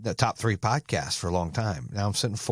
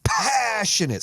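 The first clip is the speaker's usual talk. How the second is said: "Passionate" stretched slow, much higher and louder; passionate shout; 11 semitones higher, 3 dB louder, much slower than usual. Shown as a demonstration. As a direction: belt "Passionate" from the chest, long "pææ". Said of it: "Say it with me".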